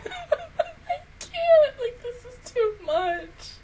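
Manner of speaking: tearful, voice breaking high; crying. Real, not acted. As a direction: choke it out through tears. Crying over the episode.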